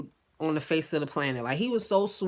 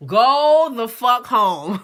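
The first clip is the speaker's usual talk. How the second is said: loud, drawn-out dismissal; fed-up order; 4 semitones higher, 8 dB louder, much slower than usual. Retold or imitated. Woman throwing her guest out of her home, fed up. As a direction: stretch it out slowly and raise the volume.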